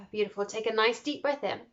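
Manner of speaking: calm guide fading to near silence; guided meditation. Acted. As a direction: drift them off gently. Leads a breathing meditation.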